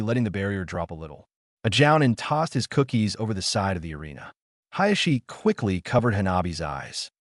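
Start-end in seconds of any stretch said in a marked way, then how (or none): none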